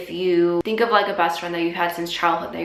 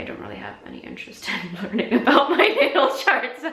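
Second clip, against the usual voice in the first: higher voice breaking into laughter; giggling through the words; apologetic laughing; 6 semitones higher than usual. Real, not acted. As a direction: laugh through an awkward refusal. Laughing while declining a request.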